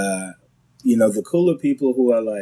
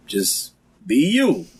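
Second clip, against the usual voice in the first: mock self-scolding; 7 semitones higher than usual. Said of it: "So I had to catch myself a few times".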